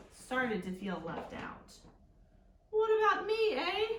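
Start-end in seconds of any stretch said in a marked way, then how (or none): 2.7-3.9 put-on high, loud Canadian accent with eh; hammed-up Canadian, complaining. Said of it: "That's Canadian Bacon".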